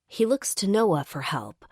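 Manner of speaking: usual speaking voice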